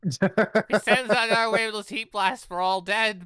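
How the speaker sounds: dramatic. Acted. Dramatic retelling of death blast.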